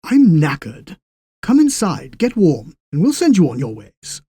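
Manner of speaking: inviting them in to warm up; tired old station master. Acted.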